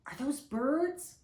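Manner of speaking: put-on wondering child, voice lifting high; little girl asking. A narrator's reading. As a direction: ask slowly with a child's curiosity. Child asks in the story.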